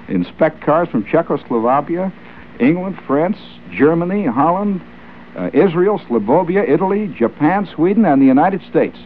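high announcer roll call of countries; ad read. Acted. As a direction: rattle off the countries like a barker. Listing countries in ad copy.

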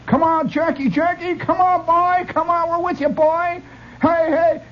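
high, excited cheering from the stands; excited hollering. Retold or imitated. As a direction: urge Jackie on like a thrilled fan at a dance-a-thon. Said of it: "my father and my mother are all excited and holler".

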